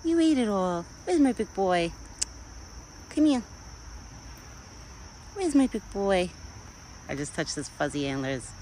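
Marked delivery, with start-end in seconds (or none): 0.0-6.3 pet talk. Real, not acted. Calling her pet deer over.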